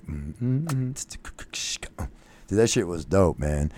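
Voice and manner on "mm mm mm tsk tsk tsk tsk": soft humming and tsk clicks; murmured mouth beat; hummed beat. Retold or imitated. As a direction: hum the beat quietly. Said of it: "me and my buddy Pete were like".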